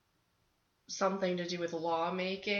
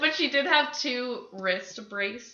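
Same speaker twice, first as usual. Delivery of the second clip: talking through laughter; giggling while explaining; laughing; 7 semitones higher, 17 dB louder than usual. Real, not acted.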